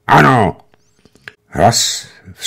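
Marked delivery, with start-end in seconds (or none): 0.1-0.6 sharp angry "Ano!", louder and higher; angry shout. A narrator's reading. Alexej snapping angrily into the phone receiver. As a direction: bark it into the phone like an insult. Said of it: "křikl do sluchátka".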